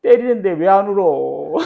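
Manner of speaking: put-on child protesting being hit while told not to cry; childish protest. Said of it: "내 생각에".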